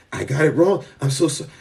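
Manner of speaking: lightly acted sorry voice; mock apology. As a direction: play it like a contrite apologizer. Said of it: "I will be the first one to apologize".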